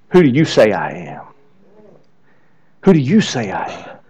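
very low and soft, sincere; quiet, pointed question. Retold or imitated. Jesus looks at his disciples and asks, quietly and pointedly. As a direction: drop the voice and let the question land.

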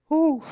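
a high exhaled whew. Real, not acted. Pastor reacting to the weight of the verse, high whew.

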